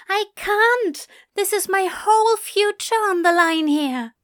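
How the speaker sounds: high, loud frantic plea; panicked protest. Acted. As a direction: insist it's life or death. Panicking over acceptance letter.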